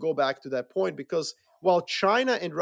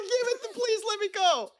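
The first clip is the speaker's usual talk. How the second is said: much higher, pleading mock whine; mock begging soldier. Soldier begging his commander to join the fight, high mock plea. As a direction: beg like a kid to be let in.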